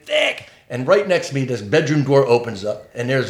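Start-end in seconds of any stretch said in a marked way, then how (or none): 0.0-0.5 high-pitched screech of a name; shrieked call, pitched way up, not full volume; mock scream. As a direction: imitate a woman screaming for someone. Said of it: "She just starts screaming".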